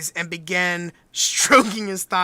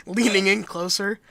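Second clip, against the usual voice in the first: normal talking voice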